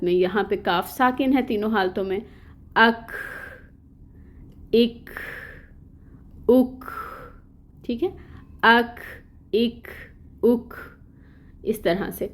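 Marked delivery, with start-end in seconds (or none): 2.7-10.7 اک ending in a puff of air like کھ; breathy kaf. Shown as a demonstration. Tajweed teacher demonstrating the whispered kaf for students. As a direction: say اک, then release the k with an audible breath. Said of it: "ہمس ہم نے کس طرح سے ادا کرنی ہے".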